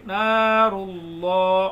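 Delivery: نار اللہ with the ra run straight into the lam, very slow; slow chanted نار اللہ, ra flowing into lam; نار اللہ drawn out, ra merged with lam; ra joined to lam. Shown as a demonstration. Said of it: "را کو لام میں ملائیں گے".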